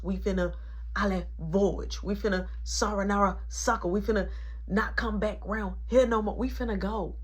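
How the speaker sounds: plain reading voice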